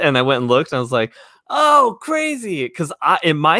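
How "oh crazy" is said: high, drawn-out amazement; retold wow moment, stretched and high; amazed reaction. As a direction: let it swoop up high and slow. Retelling his amazed reaction.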